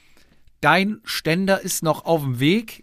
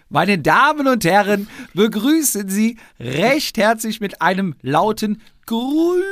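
rising, louder ring-announcer build; grand entrance announcement; 7 semitones higher, 3 dB louder than usual. Acted. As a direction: announce him like a boxing champ. Introduces co-host like an announcer.

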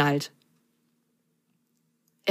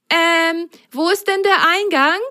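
puzzled sock; 12 semitones higher than usual. Acted. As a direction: ask it a bit baffled. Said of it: "fragte Apfelgrün".

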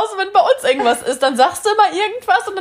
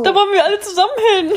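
quick, higher laughing remark; 5 semitones higher, much faster than usual. Real, not acted. Listener reacting to friend's story, laughing. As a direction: blurt it out through laughter.

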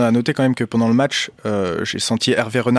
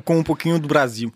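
higher, put-on Brazilian lilt; Brazilian Portuguese flourish; 4 semitones higher than usual. Guest jokingly claiming favela roots to hosts, Brazilian Portuguese flourish. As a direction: toss it off with a showy Brazilian accent.

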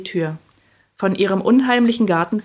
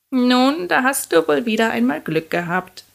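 higher-pitched witch's grumble; grumbling; 5 semitones higher, 3 dB louder than usual. A narrator's reading. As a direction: grumble grudgingly in a raised voice. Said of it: "brummte sie".

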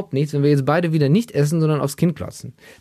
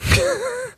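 high giggle. Real, not acted. Laughs at own image.

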